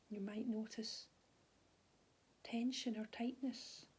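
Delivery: calm, unhurried body scan guidance with long pauses; soothing mindfulness teacher voice; guided meditation. Real, not acted. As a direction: gently guide them through their body, leaving space after each phrase.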